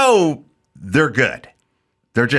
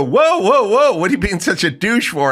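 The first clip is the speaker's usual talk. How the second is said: mock indignant kid; 9 semitones higher than usual. Young guy objecting to being pushed, hammed-up indignant whine. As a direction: play the offended youngster, hammed-up.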